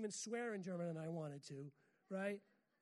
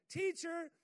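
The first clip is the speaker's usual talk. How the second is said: panicked cry; 8 semitones higher, 7 dB louder than usual. Acted. Disciples crying out to Jesus.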